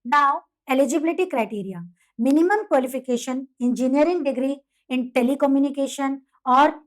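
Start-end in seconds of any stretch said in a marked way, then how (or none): none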